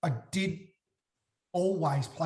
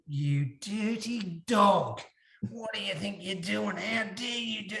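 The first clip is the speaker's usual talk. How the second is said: put-on slow, higher scolding old man; an old bloke tearing strips off you; angry old man; 5 semitones higher, slower than usual. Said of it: "some bloke, seventy-eight years of age".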